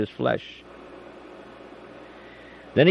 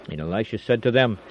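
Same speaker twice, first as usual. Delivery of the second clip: plain reading voice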